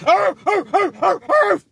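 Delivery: high loud barking like a small dog; yapping dog bark. Retold or imitated. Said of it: "that was the sound".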